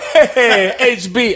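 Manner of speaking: loud, high, over-eager hello; mock friendly greeting. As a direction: imitate a two-faced friend calling out a greeting. Mimics friend greeting him in person.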